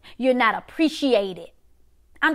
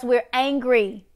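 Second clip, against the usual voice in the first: ordinary talk, nothing marked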